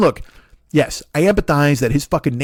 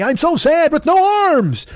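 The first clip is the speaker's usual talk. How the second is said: much higher, slow, hammed-up self-pitying whine; mock whining; 12 semitones higher, slower than usual. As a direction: wail it like a big baby. Mocking the veteran's self-pity.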